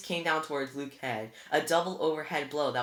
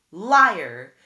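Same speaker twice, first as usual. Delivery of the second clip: loud, high shout; shouted accusation; 6 semitones higher, 16 dB louder than usual. A narrator's reading. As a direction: throw the word at him. Annabeth shouting at Luke.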